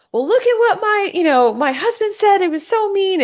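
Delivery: high-pitched mock whining to a confidant; aggrieved complaint. Wife telling chat what her husband said, aggrieved complaint.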